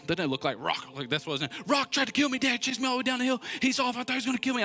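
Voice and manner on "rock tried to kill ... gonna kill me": put-on high, breathless retelling of a frightened boy; panicked kid. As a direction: pitch jumps up like a scared twelve-year-old. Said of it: "I'm just, like, hysterical in this moment".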